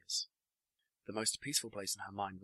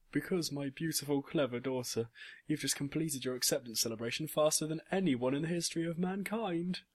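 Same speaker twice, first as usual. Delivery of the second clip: high, loud, excited praise; gushing pride; 7 semitones higher, 5 dB louder than usual. A narrator's reading. Father praising his clever daughter, gushing pride. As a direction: build proudly to a high finish.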